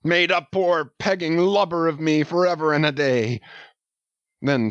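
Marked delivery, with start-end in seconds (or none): 0.0-3.4 higher, a mild animal sob in a shout; half howl, half sob of a wounded old captain; sobbing shout. A narrator's reading. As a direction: heartbroken old captain crying out, understated. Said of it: "he shouted with a terrific, loud, animal sob".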